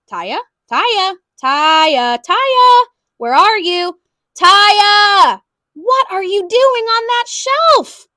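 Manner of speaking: father searching for his daughter; calling out. A narrator's reading. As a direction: call through the store for her.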